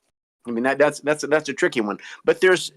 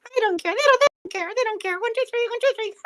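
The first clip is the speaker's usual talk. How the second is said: high puppet voice. Acted. Puppet voice again.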